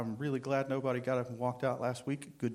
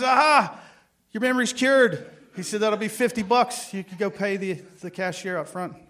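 gleeful engineer; 8 semitones higher, 9 dB louder than usual. Acted. Triumphant engineer in retold joke.

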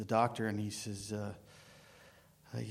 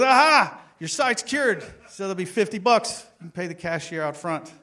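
gleeful engineer; 7 semitones higher, 9 dB louder than usual. Acted. Triumphant engineer in retold joke.

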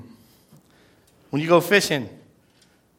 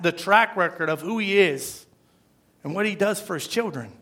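ordinary talk

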